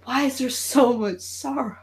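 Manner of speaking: a hint of a sulky office Pinhead moaning about chips; whiny Pinhead. Pinhead whining to coworkers in a cubicle, soft and low. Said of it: "Pinhead in a fucking cubicle going".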